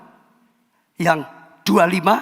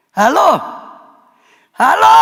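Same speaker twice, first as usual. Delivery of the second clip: high, louder call for a response; 8 semitones higher, 5 dB louder than usual. Real, not acted. Preacher calling out to a silent congregation for a reply. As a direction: rouse the silent room.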